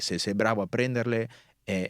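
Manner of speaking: ordinary delivery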